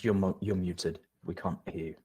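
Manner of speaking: ordinary delivery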